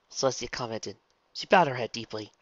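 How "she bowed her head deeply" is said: usual speaking voice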